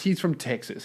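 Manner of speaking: normal talking voice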